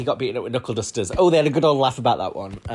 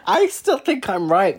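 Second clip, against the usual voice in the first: laughing insistence; 9 semitones higher, 4 dB louder, slower than usual. Real, not acted.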